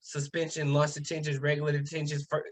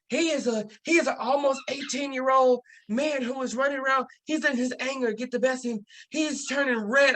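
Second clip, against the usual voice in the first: higher-pitched, overblown accusation; indignant principal; 7 semitones higher than usual. Retold or imitated. Principal accusing the student in front of grandma, indignant. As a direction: imitate an alarmed official building a case.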